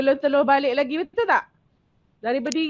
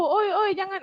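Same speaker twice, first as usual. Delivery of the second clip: rude calling out; 8 semitones higher than usual. Retold or imitated. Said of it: "Jangan cakap".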